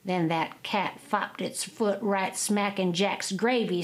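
ordinary delivery, nothing marked

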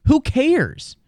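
high scoffing who cares; dismissive. Real, not acted. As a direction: brush it off, high. Mocking whiteout games.

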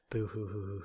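mock sobbing boo hoo, hammed up; sarcastic pretend weeping; fake crying. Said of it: "Feel bad for me".

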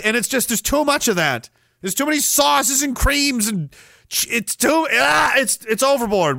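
high-pitched mock outrage, rising; exasperated rant. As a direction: rail against sauces like it's a crisis. Ranting against sauces and creams.

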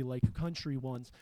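normal talking voice

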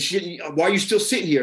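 loud, high, angry scolding; aggressive retold. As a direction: scold him hard, pitch up and push volume. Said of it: "I was very, like, aggressive".

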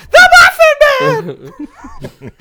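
very high, slow, loud squeaky scream; gingerbread man voice. Retold or imitated. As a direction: shriek it out through laughter. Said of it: "he sounds more like the gingerbread man".

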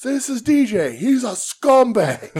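high, loud announcement, slowing at the end; mock public denunciation. Wrestler presenting a fan to the line, loud mock denunciation. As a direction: call him out to everyone, hammed-up. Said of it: "called everybody in the line".

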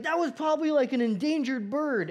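high-pitched mock alarm; horrified dad. Dad to son about the dead bird, hammed-up horror. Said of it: "my dad is horrified".

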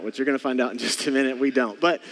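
ordinary delivery

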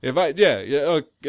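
ordinary delivery

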